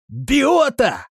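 high, loud astonished exclamation; great amazement. A narrator's reading. Fellow astonished to hear Biota in the well, amazed cry. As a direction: blurt the name in amazement. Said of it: "сильно изумился жердяй".

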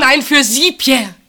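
high, loud, pleading denial; passionate protest. Acted. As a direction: cry out that it was for him. Heated protest in quarrel. (leidenschaftlicher, lauter Widerspruch)

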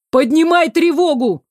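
loud, high shout on the run; shouting while running. A narrator's reading. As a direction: yell the alarm while running. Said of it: "крикнул на бегу".

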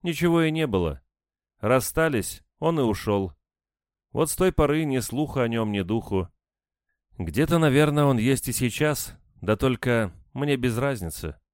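reluctant, dismissive woman. A narrator's reading. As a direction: make out you don't care where he is. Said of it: "нехотя ответила женщина". (нехотя, с деланным безразличием)

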